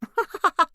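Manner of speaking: high tinkly polite laugh; awkward laugh. A narrator's reading. Neighbour laughing off a rude joke, high and polite.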